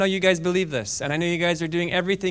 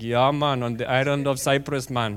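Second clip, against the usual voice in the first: playful put-on Jamaican accent; 5 semitones lower than usual. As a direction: do Paul as a laid-back Jamaican. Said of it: "with my Jamaican accent".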